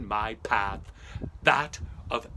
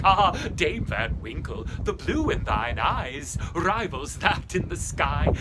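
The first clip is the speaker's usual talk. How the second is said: flattering; 3 semitones higher, 8 dB louder than usual. Acted. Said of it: "get invited by saying things like".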